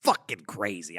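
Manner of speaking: ordinary talk, nothing marked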